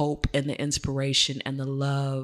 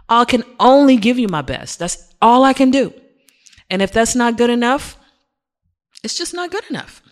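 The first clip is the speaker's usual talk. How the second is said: raised emphatic voice; 9 semitones higher, 7 dB louder than usual. Real, not acted. Teacher defending giving his best.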